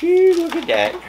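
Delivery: high, loud amazed gasp of delight; excited. Real, not acted. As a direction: marvel at it out loud. Admiring the new train car.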